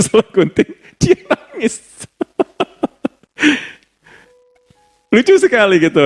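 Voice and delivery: high-pitched words through laughter; laughing. Real, not acted. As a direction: giggle through the story. Laughing story about bad haircut. (menceritakan gunting rambut yang salah sambil tertawa)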